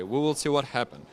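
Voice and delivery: ordinary talk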